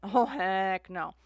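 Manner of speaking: normal talking voice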